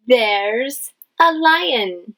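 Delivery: ordinary talk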